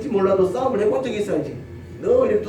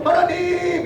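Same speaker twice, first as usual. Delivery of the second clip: much higher call of the name; high cry to God; 10 semitones higher, much slower than usual. Retold or imitated. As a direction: cry out hopefully. Moses crying out.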